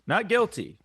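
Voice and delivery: loud mock protest. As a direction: shout it out, defensive. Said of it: "denying the accusation".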